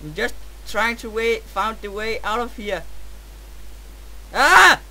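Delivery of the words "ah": short, loud, high cry of alarm; sharp startled shout; startled yell. Real, not acted. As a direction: yell "ah" as if suddenly hit. Hurt or startled in game.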